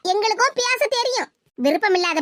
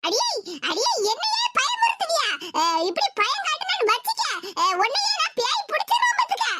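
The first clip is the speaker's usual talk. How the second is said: higher, crying threat; shaky threat; 6 semitones higher than usual. Acted. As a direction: threaten the ghost while scared. Threatening the ghost fearfully. (பேயை நடுக்கத்துடன் மிரட்டும் குரல்)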